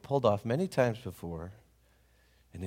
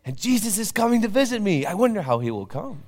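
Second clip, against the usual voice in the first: excited; 13 semitones higher, 8 dB louder than usual. Acted. Papa waking on Christmas morning, high and thrilled. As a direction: savour the big day aloud. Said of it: "he was excited".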